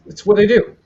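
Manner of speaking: normal talking voice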